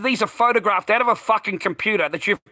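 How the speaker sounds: high, furious reenacted tirade; retold going off. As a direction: build into a heated dismissal. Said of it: "I went off my head".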